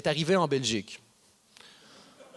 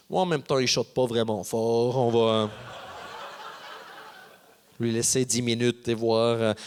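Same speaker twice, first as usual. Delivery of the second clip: laid-back chuckling cop; 7 semitones lower than usual. Retold or imitated. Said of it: "aucun policier qui rit".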